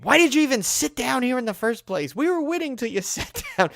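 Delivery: high, loud accusing outburst; mock yell at the woman who took her spot; retold angry outburst. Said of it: "the little mutterings became, like, a full out".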